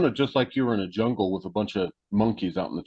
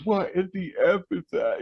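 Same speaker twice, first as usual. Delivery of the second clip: mock freaked-out cry; 7 semitones higher than usual. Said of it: "she was freaked out".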